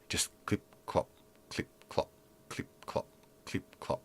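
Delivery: whispered, evenly spaced clip-clop; imitated footsteps. Storyteller mimics footsteps going on for a minute, hushed clip-clop. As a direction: tick out endless footfalls under the breath. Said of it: "feet just clip-clopping on the wooden".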